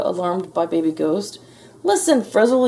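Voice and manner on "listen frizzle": high, loud call for attention; yell. A narrator's reading. Gus interrupting angry Frizzle, yelling. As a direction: yell to cut him off. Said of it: "yelled Gus".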